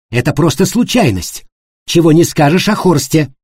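loud, high furious denial. A narrator's reading. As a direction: lash out in fury. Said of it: "Аника в ярости вскочила".

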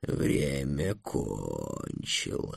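very slow, low, soft dragon voice; deep, unhurried pronouncement; solemn dragon. A narrator's reading. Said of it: "торжественно сказал дракон".

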